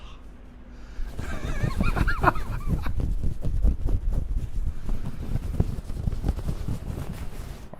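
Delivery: very high squeaky gremlin cackle; gremlin laugh. Pretend. Showing chat a gremlin voice, high cackle. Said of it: "A gremlin voice".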